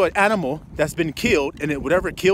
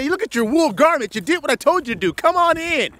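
higher, lightly voiced approving God; mock God's welcome; 6 semitones higher than usual. Preacher mimicking God welcoming the rule-keeper, mock approval. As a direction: hand down a sarcastic welcome. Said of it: "He's gonna say".

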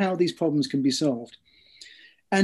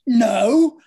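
higher, louder mock heckle; imitated shout; 6 semitones higher, 7 dB louder than usual. Author mimicking a little voice from the theatre stalls, shouting. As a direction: call it out like a little voice from the audience. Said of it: "a little voice comes from the stalls shouting".

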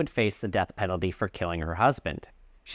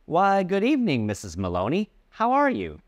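cheery grocer; 8 semitones higher than usual. Acted. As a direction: welcome a regular customer warmly. Grocer greets customer.

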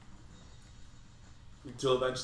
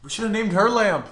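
excited; 8 semitones higher, 11 dB louder than usual. Real, not acted. Excited joke about a name.

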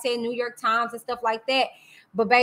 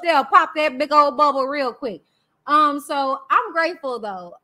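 normal talking voice

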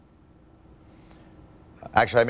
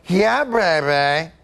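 high, drawn-out mock English accent yell; hammed-up English accent, slow and high; John Oliver; 7 semitones higher, much slower than usual. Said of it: "he just yells".

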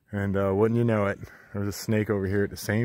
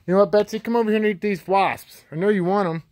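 calling out; 9 semitones higher, 6 dB louder than usual. Real, not acted. Calling chicken over.

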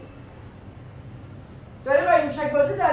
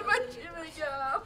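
slow, softer, breaking into tears; crying; 10 dB quieter, slower than usual. Acted. Mother asking the family what she can say, crying softly. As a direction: let the words dissolve into crying. Mother despairing over stolen son.

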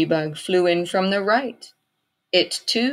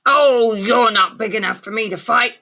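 grouchy ladybug voice; 5 semitones higher, 6 dB louder than usual. A narrator's reading. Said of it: "it said".

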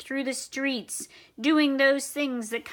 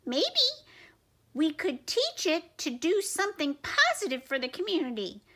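much higher, scolding squeak; squeaky mouse voice; 4 semitones higher than usual. Acted. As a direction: taunt the troops in a tiny voice. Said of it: "suggested the Big Cheese".

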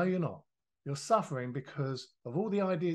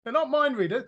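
higher and louder, exasperated; telling off a young trader, louder and higher; exasperated retort; 9 semitones higher, 6 dB louder than usual. Retold or imitated. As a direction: throw the question back in disbelief. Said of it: "I said".

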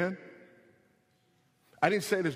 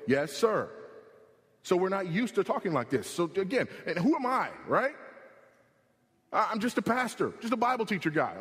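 higher and faster pulpit cadence; revved-up sermon delivery; fired-up preaching; 6 semitones higher than usual. Acted. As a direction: preach it with rising urgency. Preacher building to full force.

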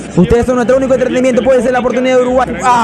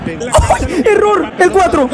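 high excited reactions; excited cries; 9 semitones higher, much slower than usual. Real, not acted. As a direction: react to the fourth goal in disbelief. Fourth goal chaos. (exclamaciones agudas de emoción)

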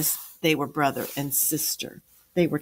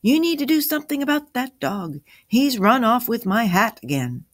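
high, raised-voice grumbling; yelled complaint; 8 semitones higher, 4 dB louder than usual. A narrator's reading. Jack complains about the dog taking his hat, yelling. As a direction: complain with the voice pitched well up. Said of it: "yelled Jack".

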